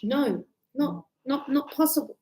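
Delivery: ordinary delivery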